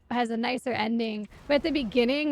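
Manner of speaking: normal talking voice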